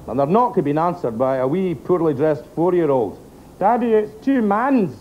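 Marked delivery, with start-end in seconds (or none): 3.6-4.9 put-on small child, higher and slow; wee girl voice. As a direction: speak as a four-year-old girl. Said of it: "the wee girl had thrown back".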